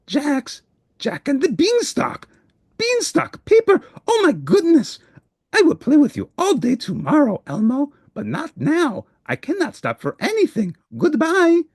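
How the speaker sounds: very high, rushed excitement; a monster suddenly remembering his errand; frantic Grover. Acted. Grover remembers the beanstalk paper.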